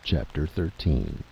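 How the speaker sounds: usual speaking voice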